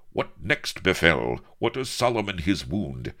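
plain reading voice

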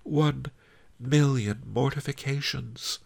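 high, plaintive moan. A narrator's reading. Mortified concubine before slamming the shutters, high moan. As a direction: moan it in humiliation, word by word. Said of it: "moaned Pretty Ping".

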